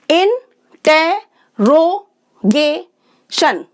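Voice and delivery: Interrogation very slow, in five separate beats; syllable by syllable. Shown as a demonstration. Teacher confirming five sounds, interrogation slowly pulled apart. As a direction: pause after each syllable of interrogation. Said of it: "पांच sound हो गई".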